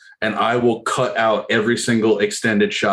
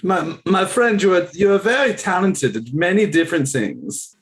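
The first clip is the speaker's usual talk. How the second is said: put-on higher-pitched, playful Russian accent; mock Russian accent; 6 semitones higher than usual.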